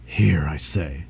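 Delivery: slow low whisper; whispered dragon. A narrator's reading. Dragon whispers to the woman on the steps. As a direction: call out under the breath. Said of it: "said he".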